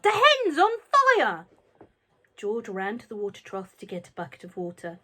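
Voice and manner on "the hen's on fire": very high, loud, slow yell. A narrator's reading. Grandma yelling from the roof at the burning hen. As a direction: cry out in alarm. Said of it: "Grandma yelled".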